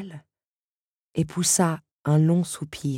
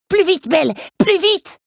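high, loud, straining shout; screaming urging; 13 semitones higher, 7 dB louder than usual. Acted. Lucanos screams at Belle to climb faster, straining shout. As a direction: yell it till the throat strains, urging her on. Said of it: "s'égosilla Lucanos".

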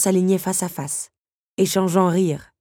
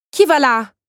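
déclamation profonde; 10 semitones higher, 9 dB louder than usual. A narrator's reading. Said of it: "déclama-t-elle de sa voix la plus profonde".

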